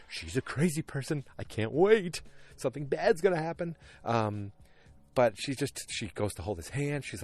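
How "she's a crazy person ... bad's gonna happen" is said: excited alarm. Retold or imitated. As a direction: act out your alarmed thought, pitch climbing. Acting out own alarmed thought.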